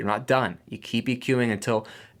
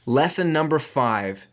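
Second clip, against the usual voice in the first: slow, higher heading; announcing a heading; 4 semitones higher, slower than usual. Real, not acted. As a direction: call out the final lesson slowly. Announcing the fifth lesson heading.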